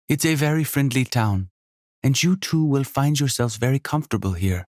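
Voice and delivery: high, lively hostess voice with a faint Jamaican lilt; a welcoming, bubbly landlady; energetic innkeeper. Acted. Sonia speaks.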